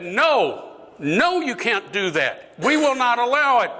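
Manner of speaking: high-pitched refusal, climbing at the end; acted defiant outcry; vehement protest. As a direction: protest harder with each phrase.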